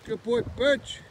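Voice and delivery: high, loud praise for the dog; excited dog praise. Real, not acted. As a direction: gush over the dog.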